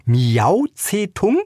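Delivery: slow, high, drawn-out disbelieving question; unbelieving. A narrator's reading. Woman reads the cat's name on the notice, slow incredulous rise. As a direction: stretch "Miau Zetung?" slowly upward as if it can't be true. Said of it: "fragte sie ungläubig".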